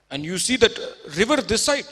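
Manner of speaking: Haryanvi farmer. Retold or imitated. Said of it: "He said".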